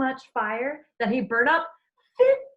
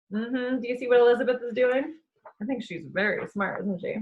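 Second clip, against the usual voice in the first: usual speaking voice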